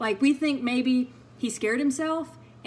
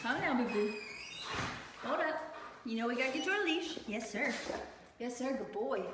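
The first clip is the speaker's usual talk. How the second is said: soothing the dog; 7 dB quieter than usual. Real, not acted. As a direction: hush him gently, like calming a scared puppy. Calming the dog at the leash.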